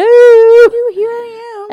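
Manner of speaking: very high, loud sing-song call. Retold or imitated. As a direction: call out across the yard. Rachel calling from driveway.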